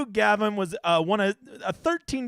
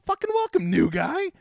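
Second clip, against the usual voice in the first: excited welcome; 9 semitones higher than usual. Real, not acted. Greeting new viewers.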